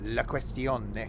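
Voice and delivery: slow, drawn-out mock Italian. Player musing on the next stop, mock Italian. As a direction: stretch it out like a hammed-up Italian. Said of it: "As they say in places where they speak like that".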